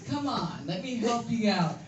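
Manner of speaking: ordinary talk, nothing marked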